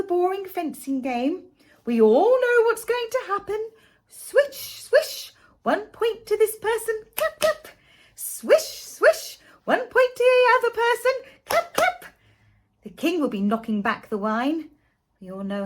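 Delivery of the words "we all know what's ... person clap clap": high, loud, sarcastic singsong; mock the ritual with bored glee; sarcastic mockery. Sarcastic mimicry of fencing.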